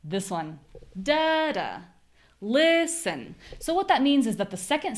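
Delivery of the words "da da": first DA high; DA da, first beat up; stress on first da. Shown as a demonstration. Teacher revealing the answer rhythm. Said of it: "The rhythm here is".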